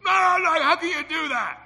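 high, loud shouted no no no; angry protest. Retold or imitated. Son shouting at his praying father in anger. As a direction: yell back in outrage. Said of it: "he was so mad".

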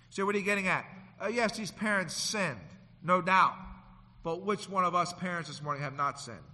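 ordinary delivery

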